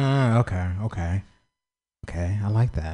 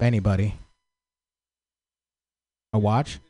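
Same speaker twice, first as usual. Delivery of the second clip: ordinary talk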